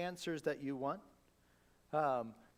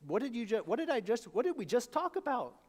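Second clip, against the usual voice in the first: higher, mock-exasperated questioning; playful exasperation; 6 semitones higher, 4 dB louder than usual. Jesus chiding the doubter, higher and mock exasperated. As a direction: tease them, mock exasperated. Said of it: "And Jesus is saying".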